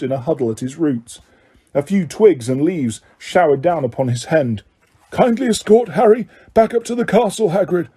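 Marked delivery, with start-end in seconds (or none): 5.1-7.9 sharp. A narrator's reading. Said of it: "said Dumbledore sharply".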